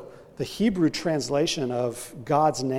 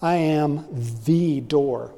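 pause, then "the" drawn out as "thee" before "door"; stress on THE; slower than usual. Shown as a demonstration. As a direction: set "the" apart and weight it. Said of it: "have definite articles".

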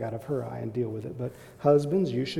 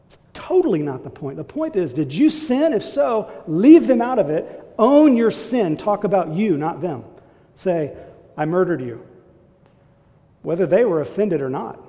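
loud, higher, driving sermon voice; preaching at full force; 4 semitones higher, 10 dB louder than usual. Acted. Pastor urging husbands to own their sin, loud preaching. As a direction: hammer the point home to the congregation.

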